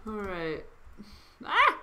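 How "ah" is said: sudden high yelp; startled yelp. Real, not acted. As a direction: jump at a scare.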